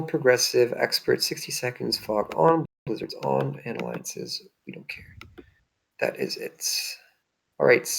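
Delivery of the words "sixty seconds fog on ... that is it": whispered under the breath, to himself; quick whispered aside; muttered aside. Real, not acted. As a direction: mutter it half to yourself while reading settings. Muttering game settings to himself.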